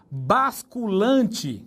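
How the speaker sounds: Basculante said slowly with a clear B, bas-cu-lan-te; slow model Basculante; careful pronunciation. Shown as a demonstration. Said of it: "Você não fala vasculante".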